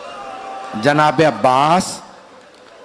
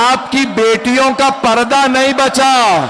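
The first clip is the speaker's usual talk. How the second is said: crying plea; 6 semitones higher than usual. Acted. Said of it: "फिर बीबी ने रो के कहा".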